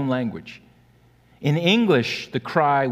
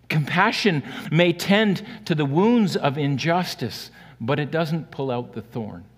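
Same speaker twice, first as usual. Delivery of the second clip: usual speaking voice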